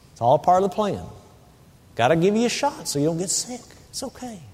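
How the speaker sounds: put-on hushed baby-talk reassurance; soothing a scared dog. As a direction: coo gently to a frightened pet. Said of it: "Just talk to her".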